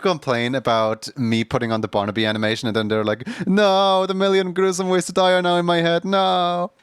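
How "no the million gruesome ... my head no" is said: high, loud mock-whiny complaint. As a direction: whine like an annoyed viewer. Mocking complaining viewers.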